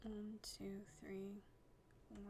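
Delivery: very soft, half-whispered counting; murmuring to oneself. Real, not acted. Player counting out blocks to herself, murmured. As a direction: count under your breath.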